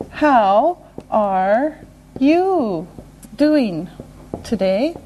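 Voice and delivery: one word, then a pause; slow and deliberate. Shown as a demonstration. Teacher modelling a greeting question, slow and separated. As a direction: break the question into single words.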